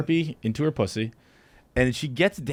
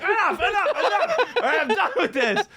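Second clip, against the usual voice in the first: high pleading through laughter; laughing protest. Retold or imitated. Comic reenacting begging the dancer to stop, laughing. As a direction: beg them to stop while cracking up. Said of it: "I'm like".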